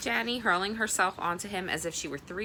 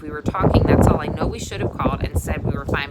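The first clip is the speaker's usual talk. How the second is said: louder, cutting in; loud interruption; 11 dB louder than usual. Acted. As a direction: cut across the parents loudly. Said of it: "interrupted Reeve".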